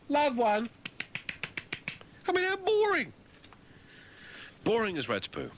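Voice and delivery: high, plummy put-on voice; mock prim lady. Complaining that tennis is boring, in a high prissy voice. Bored mocking tennis rant.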